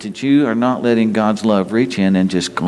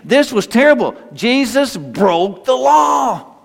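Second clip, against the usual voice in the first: mock scandalized; 7 semitones higher than usual. Preacher voicing shocked objectors to the leper's touch, scandalized. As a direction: build indignantly to a high, stretched finish.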